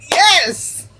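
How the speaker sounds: high, elated shout of approval; triumphant cheer. Retold or imitated. Host reliving her reaction to the line, elated. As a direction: cheer it like a fan.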